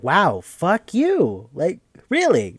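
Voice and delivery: flat-out sarcastic reply to the game; sarcastic retort. Pretend. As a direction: drag it out, mocking and unimpressed.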